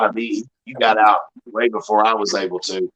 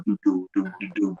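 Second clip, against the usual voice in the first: imitated bass; 4 semitones lower, 7 dB quieter than usual. Imitating car bass going by.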